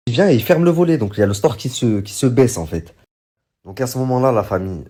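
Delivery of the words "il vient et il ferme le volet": incredulous retelling. Retold or imitated. Wife's outraged account repeated.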